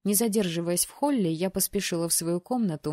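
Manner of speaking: usual speaking voice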